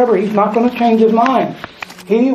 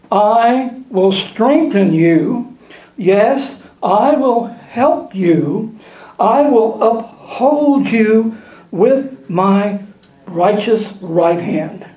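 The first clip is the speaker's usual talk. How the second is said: slow scripture reading; much slower than usual. Acted.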